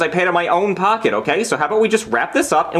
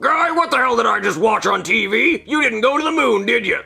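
higher, exasperated boss voice; angry boss; 6 semitones higher than usual. Acted. NASA boss on the phone scolds Guy, lightly voiced and exasperated. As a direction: play the boss furious on the phone. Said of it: "it's my boss from nasa".

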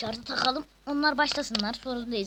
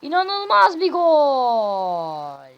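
slow, stretched goal call, much louder; screaming football commentator; 3 semitones higher, 11 dB louder, much slower than usual. Acted. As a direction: scream the goal like a live announcer. Calling the goal.

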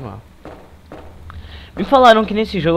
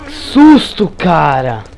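high startled shout; frightened shout; 3 semitones higher, 10 dB louder, much slower than usual. Real, not acted. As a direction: jump and yell in fright. Zombie jump scare.

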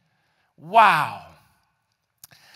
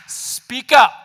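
loud, higher call to action; forceful exhortation; 5 semitones higher, 9 dB louder than usual. Real, not acted. Preacher urging the congregation to speak, loudly. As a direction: punch it out to the whole room.

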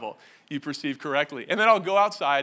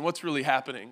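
usual speaking voice

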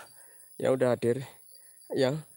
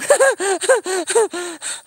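higher, louder burst of nervous laughter; 12 semitones higher, 4 dB louder than usual. Real, not acted. Streamer laughing at his own fright, nervous laugh.

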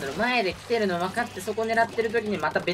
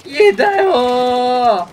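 drawn-out despairing wail; hit hard crying out; 5 semitones higher, 11 dB louder, slower than usual. Real, not acted. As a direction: wail it out, stretched and loud. Lament after teammate vanishes.

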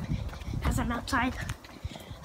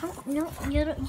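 ordinary talk, nothing marked

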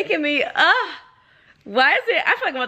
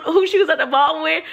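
ordinary talk

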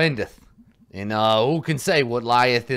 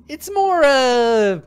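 teasing voice; 11 semitones higher, 7 dB louder, slower than usual. Acted. Teasing high voice.

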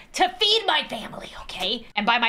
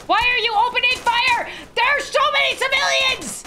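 high, alarmed yelling; frantic high-pitched protest; outraged shouting; 10 semitones higher, 3 dB louder than usual. Pretend. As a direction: scream at the police in disbelief. Police open fire in game.

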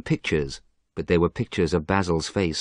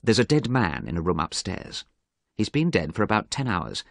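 ordinary delivery, nothing marked

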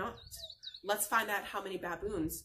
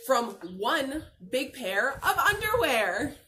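louder, slower reveal; big punchline; 2 semitones higher, 7 dB louder, slower than usual. Acted. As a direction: land the silly punchline. Punchline of read-aloud book.

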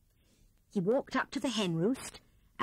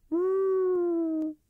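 loud high hooting ooh; owl hoot; 11 semitones higher, 6 dB louder than usual. A narrator's reading. Owl hooting as he shuts his door on Rat. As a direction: end with a long hoot.

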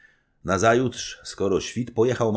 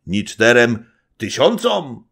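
slower, louder mimicking echo; mocking old man; 8 semitones higher, 10 dB louder than usual. Old nobleman mocking the young wife's boast, loud. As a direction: mimic her words to mock them. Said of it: "rzekł przedrzeźniając Zagłoba".

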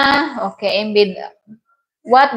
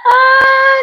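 excited shriek; 5 dB louder than usual. Real, not acted. Shrieks at chat comment.

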